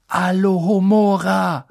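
loud, slow ringing incantation; spell incantation. A narrator's reading. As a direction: cast it boldly. Casting a spell.

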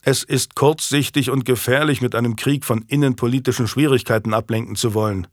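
plain reading voice

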